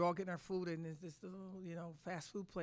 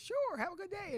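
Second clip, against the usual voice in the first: imitating a Southern accent, pitched much higher and louder; Pat Summitt's Southern accent; 13 semitones higher, 7 dB louder than usual. Coach imitating Pat Summitt greeting her with a Southern accent. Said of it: "And she said".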